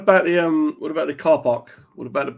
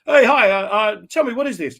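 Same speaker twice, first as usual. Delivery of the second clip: higher, bouncy mock interviewer voice; mock eager interviewer; 7 semitones higher than usual. Host imitating Kev in the market, high and hammed-up. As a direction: play a keen reporter hamming it up.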